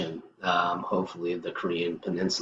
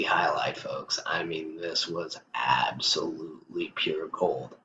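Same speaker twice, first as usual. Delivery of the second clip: soft, whispered gleeful aside; hushed delight; 3 semitones lower, 7 dB quieter than usual. Real, not acted. Vlogger whispers the highlight to his viewers. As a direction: lean in and hush it to the viewers. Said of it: "I'm almost laughing right now".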